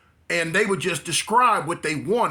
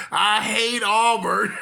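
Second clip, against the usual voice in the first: mock shout; 5 semitones higher, much slower than usual. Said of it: "He on there now talking about".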